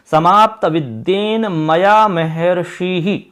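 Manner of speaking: slowly spelling out the line; slow dictation. Shown as a demonstration. Teacher dictates the anvaya's first line. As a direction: read समाप्त विद्येन मया महर्षिः slowly and clearly. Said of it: "आपको लिखना होगा समाप्त विद्येन".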